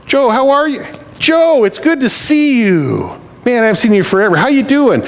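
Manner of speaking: high-pitched, gushing mock hello; hammed-up, high glad-to-see-you; effusive greeting. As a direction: greet an old pal with overdone delight. Acting an effusive greeting to Joe.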